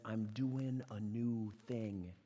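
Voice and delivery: I'm doing a new thing drawn out slowly and softly; slow, quiet voice of God. Acted. Pastor voicing God, slow and hushed. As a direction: lay each word down like a quiet promise. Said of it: "the first donkey is one that says".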